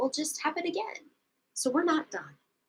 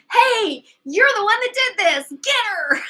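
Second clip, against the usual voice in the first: mob shouting; 9 semitones higher, 8 dB louder than usual. Retold or imitated. Mob turns on her.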